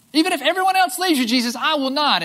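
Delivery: high, loud, fast vow; Peter's vow. Acted. Peter vowing to Jesus he won't fall away, loud and high. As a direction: swear it to him with all you've got. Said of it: "Peter declared".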